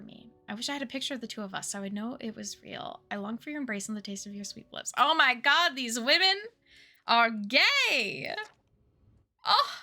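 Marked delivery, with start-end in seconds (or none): 5.0-9.7 loud, thrilled exclamation, swelling at the end; excited outburst. Real, not acted. Player discovering the love letters, loud excited outburst. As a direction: burst out in loud, gleeful discovery.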